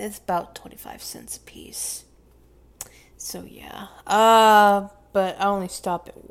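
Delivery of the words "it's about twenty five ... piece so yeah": guilty unvoiced whisper. Real, not acted. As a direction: confess it under your breath. Confessing snack theft quietly.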